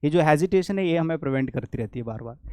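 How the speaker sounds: usual speaking voice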